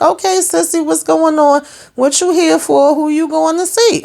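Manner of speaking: high, eager questioning; curious excitement. Pretend. Recapper quizzing Sissy on screen, high and eager.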